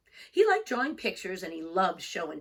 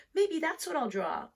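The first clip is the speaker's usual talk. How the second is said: put-on higher eager child voice; a child catching a new idea; Franklin's child voice; 9 semitones higher than usual. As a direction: lift it up eagerly.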